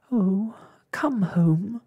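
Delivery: high, slow storybook wail. A narrator's reading. Ragged Blossom pleads to leave the hospital, wailing. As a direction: draw the plea out into a wail. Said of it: "wailed Ragged Blossom".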